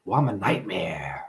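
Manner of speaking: low, eerie whisper, voice dropped away. Acted. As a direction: breathe it like a secret threat. Ominous self-description to audience.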